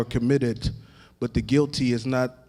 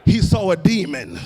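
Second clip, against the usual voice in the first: rising, shouted and half-sung preaching, much higher and louder; full-force preaching; 6 semitones higher, 4 dB louder than usual. Acted. Preacher building to full cry.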